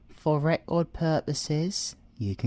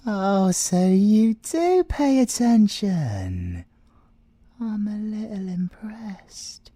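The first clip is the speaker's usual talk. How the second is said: slow and louder, playfully pleased; amused tease; 9 semitones higher, 7 dB louder, slower than usual. Acted. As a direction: savour the jab slowly. Teasing the inspector.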